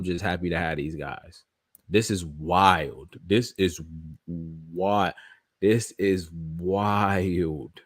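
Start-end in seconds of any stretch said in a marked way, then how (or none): 1.9-7.8 slow, drawn-out awe; slow stunned. Real, not acted. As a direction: let it sink in slowly. Stunned, repeating himself slowly.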